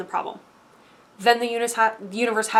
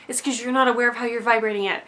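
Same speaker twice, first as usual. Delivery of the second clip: normal talking voice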